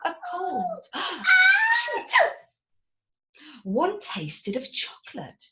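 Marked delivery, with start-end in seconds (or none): 0.9-2.4 loud high shocked gasp; high theatrical gasp of shock; big gasp. Acted. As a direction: gasp as if horrified. Dramatic gasp in story.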